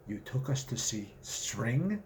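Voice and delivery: soft, slow, incredulous; can't believe it, quietly; appalled disbelief. Acted. Bundy appalled at string.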